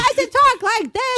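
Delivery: high, slow put-on voice; mock mom voice. Co-host guessing at friend's mom, high mock voice. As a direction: stretch it out in a silly high pitch. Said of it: "What is your mom's voice?".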